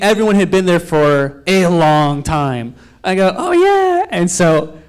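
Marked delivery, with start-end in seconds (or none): 3.4-4.1 very high-pitched, put-on innocence; feigned agreeableness. Newcomer answering the spinning instructor, feigning innocence. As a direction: play dumb in a high voice.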